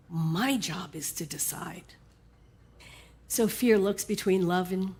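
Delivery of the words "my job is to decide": soft, slow whispered resolve. Acted. Faith tells Fear her role in a slow whisper. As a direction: whisper it slowly like a secret truth. Faith's firm quiet point.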